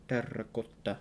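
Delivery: softly said "Terracotta" with rolled r; Latin pronunciation. Shown as a demonstration. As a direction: murmur "Terracotta" the Latin way, rounded o. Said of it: "be pronounced like that".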